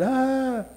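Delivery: short high fretful yelp; mock exasperated cry. Preacher imitates a stressed life with a high anxious yelp. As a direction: let out a quick frazzled cry.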